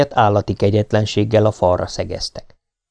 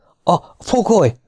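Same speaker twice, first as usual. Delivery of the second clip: alarmed; 9 semitones higher than usual. Acted. Alarmed sergeant reports.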